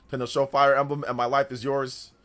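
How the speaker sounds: usual speaking voice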